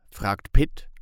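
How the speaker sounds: ordinary delivery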